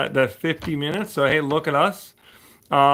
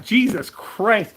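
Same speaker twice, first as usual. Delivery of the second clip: exasperated; 9 semitones higher, 6 dB louder, slower than usual. Real, not acted. Exasperated by hat comments.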